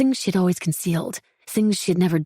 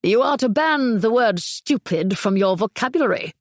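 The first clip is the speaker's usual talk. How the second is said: crisp British accent, pitched up in mockery; mocking British accent; 4 semitones higher than usual. Margot mimicking the psychologist to Beverly, British accent. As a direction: parrot the doctor in a prim British accent. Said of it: "parroted in a crisp British accent".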